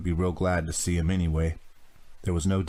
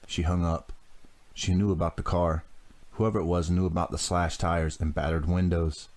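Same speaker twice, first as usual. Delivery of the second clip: ordinary delivery, nothing marked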